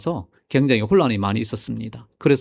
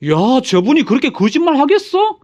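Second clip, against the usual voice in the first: high, louder rhetorical protest; acted incredulity; 9 semitones higher, 3 dB louder than usual. Follower defending the preacher against doubt, acted incredulity. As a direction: imitate a believer's indignant disbelief. Acts out a trusting follower's objection.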